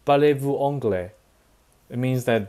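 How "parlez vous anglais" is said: "Parlez-vous anglais?" in careful French; French phrase. Shown as a demonstration. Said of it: "another phrase".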